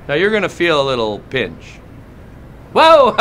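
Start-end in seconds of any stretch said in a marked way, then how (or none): 2.7-3.1 sudden high, loud whoa; startled shout. Acted. TSA agent yelps as the passenger flinches, startled shout. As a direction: jump back with a yelp.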